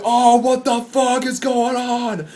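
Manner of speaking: high-pitched, protesting wail; complaining fans. Retold or imitated. Fans to the band bolting offstage, aggrieved protest. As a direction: imitate upset fans whining at the band. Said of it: "fans just go like".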